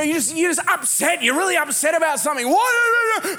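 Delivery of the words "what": much higher, loud snapped what; mock angry snap. Upset spouse snaps during an argument. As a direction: bark it out irritated.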